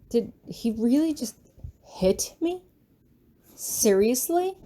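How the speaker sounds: can't believe he did that; disbelief. Real, not acted. Disbelief after being slapped.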